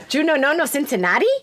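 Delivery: put-on Misha Morell accent. Host showing the accent she practised, Misha Morell accent. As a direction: show off the Belgian-Cuban accent he learned. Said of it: "trying to perfect the Misha Morell accent".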